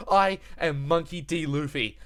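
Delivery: loud, punchy declaration; punchy dramatic comic narration. Acted. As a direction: end it like a cliffhanger narrator. Punchy recap of Luffy's declaration.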